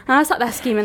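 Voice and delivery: ordinary delivery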